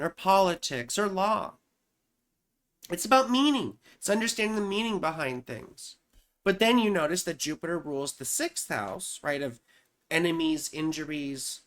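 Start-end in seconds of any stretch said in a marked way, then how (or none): none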